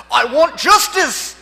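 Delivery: loud, high, hammed-up Scottish cry; Scottish accent. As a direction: bellow it slowly as an outraged mock Scotsman. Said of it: "it's just funnier 'cause it's a Scottish accent".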